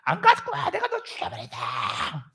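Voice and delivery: hammed-up enraged screaming; screaming master. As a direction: shout a death threat like a raging tyrant. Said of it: "소리 지르잖아요".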